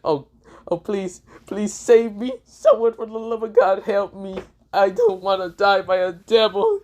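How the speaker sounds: frantic begging. Acted. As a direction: beg for your life, overacted. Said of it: "Issei said".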